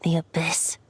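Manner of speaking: breathy hushed murmur to himself; low, brooding whisper; ominous whisper. Acted. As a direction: whisper it like a dark secret. Venti murmuring ominous names alone.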